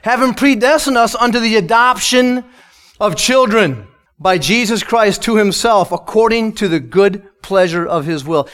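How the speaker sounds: reading the verse out with weight; scripture reading. Acted. Preacher reading the verse to the congregation, solemnly. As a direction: read it to the congregation.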